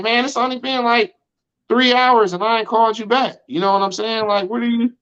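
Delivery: put-on high, exasperated complaint to a girl who overreacts; exasperated disbelief. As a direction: protest that it's only been hours. Exasperated at her blocking threat.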